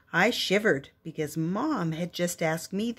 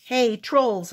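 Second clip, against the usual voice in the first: louder call to get their attention; 4 semitones higher, 5 dB louder than usual. Acted. A child calling out to the squabbling trolls. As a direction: call across the hut. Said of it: "I called".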